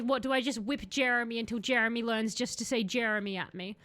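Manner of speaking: ordinary talk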